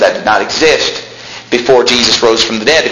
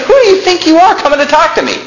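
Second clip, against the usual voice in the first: much higher, offended retort; angry Elisha; faster than usual. Retold or imitated. Elisha snapping at the wicked king, angry. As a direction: throw the question back with contempt. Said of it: "do you see the anger?".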